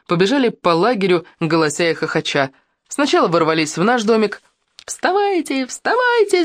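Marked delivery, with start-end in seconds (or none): 4.9-6.4 high, ringing call with little added volume; acted wake-up yell. A narrator's reading. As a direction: rouse everyone with stretched, high-pitched cries. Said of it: "закричали мы". (высокий протяжный крик, будим всех)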